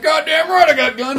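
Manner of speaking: put-on high, loud gruff old-timer boast; cocky old man. Old George bragging to Denzel about his guns, loud high boast. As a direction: crow it like a proud old rancher.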